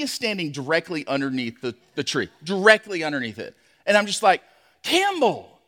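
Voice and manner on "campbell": calling his son's name. Retold or imitated. Dad calling out to son.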